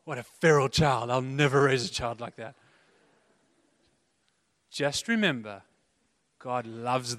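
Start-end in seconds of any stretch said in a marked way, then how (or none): 0.0-2.5 judgy parent. Retold or imitated. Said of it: "You're like".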